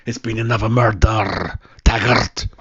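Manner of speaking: put-on a grim Scottish detective accent; Taggart's Scottish voice. Doing his only impression for the chat, Scottish detective accent. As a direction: lay on a Glasgow detective's Scottish burr. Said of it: "Not bad, is it? ... That's the only one I can do".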